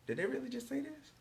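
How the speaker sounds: can't believe what you just heard; disbelief. Retold or imitated. Hearing a prospective mentor say something shocking, disbelieving. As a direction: pitch jumps way up in shock.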